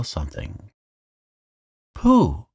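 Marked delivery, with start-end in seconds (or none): 1.9-2.5 much higher, louder call; cry out. A narrator's reading. Piglet warning Pooh of something on his back, urgent cry. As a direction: call out in alarm. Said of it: "he cried".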